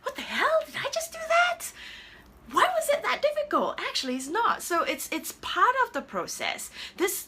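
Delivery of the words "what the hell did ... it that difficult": high exasperated squeak of frustration; mock frustrated outburst; imitating her frustrated self. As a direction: act out her own despair at the canvas.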